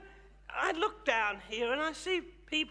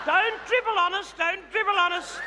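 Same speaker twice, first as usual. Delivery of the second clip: mock scolding; 5 semitones higher, 5 dB louder than usual. Teasing audience in gallery.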